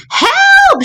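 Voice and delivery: high panicked scream for help. Acted. Little sister waking from a nightmare, screaming for help. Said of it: "she screamed".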